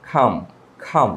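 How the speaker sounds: খাম with aspirated K and no L; silent L. Shown as a demonstration. Teacher gives the correct pronunciation, silent L. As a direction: go from the long vowel to M in খাম. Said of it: "But এটা উচ্চারণটা হবে".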